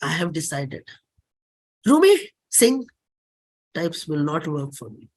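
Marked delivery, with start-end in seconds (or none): 1.8-2.8 high and loud mock command; mimicking a bossy request to sing; imitating a pushy demand. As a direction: toss off the order like a nagging fan. Quoting people's demand.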